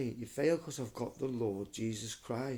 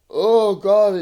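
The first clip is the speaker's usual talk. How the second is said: loud, high, pained "oh God"; real sick moan; feverish groan; 9 semitones higher, 17 dB louder than usual. Real, not acted. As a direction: groan out through the chills. Feverish, shivering, complaining.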